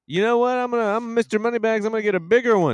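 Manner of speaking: mock big spender. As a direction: lampoon a showy big spender. Mocking the friend's big spender boast.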